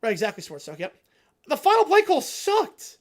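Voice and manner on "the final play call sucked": loud, slow frustrated complaint. Real, not acted. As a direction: spit it out in disgust. Ranting about play calls.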